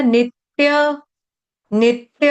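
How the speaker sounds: नित्य said slowly as nit-tya; nit, a tiny hold, then tya in नित्य; stressed double t. Shown as a demonstration. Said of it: "आघात".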